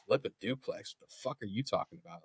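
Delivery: hushed, disbelieving mutter; incredulous aside. Retold or imitated. Unspoken reaction to sergeant's order.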